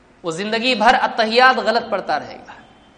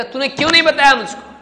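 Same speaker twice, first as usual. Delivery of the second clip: higher, louder acted complaint; annoyed reproach; 6 semitones higher, 6 dB louder than usual. Man confronts his brother for not telling him, annoyed reproach. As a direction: demand an explanation, acting out the upset brother. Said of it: "وہ زیادہ ناراض ہوگا".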